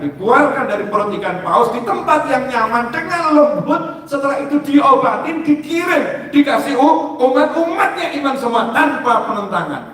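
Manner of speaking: high, excited telling of the miracle; rising excitement. Acted. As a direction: build the wonder of the rescue. Storytelling climax.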